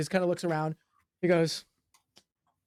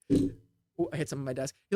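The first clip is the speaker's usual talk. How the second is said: ordinary talk, nothing marked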